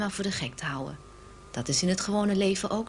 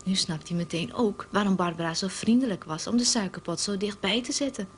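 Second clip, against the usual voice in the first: normal talking voice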